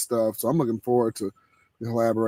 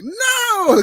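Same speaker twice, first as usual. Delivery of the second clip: high, loud protesting no; mock yell no; 4 dB louder than usual. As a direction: shout no in horror. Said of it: "And I'm like".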